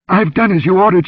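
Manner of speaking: high, loud, panicked plea; hysterical gasp. A narrator's reading. Frightened dancer reports to her rescuer, hysterical. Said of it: "she gasped hysterically".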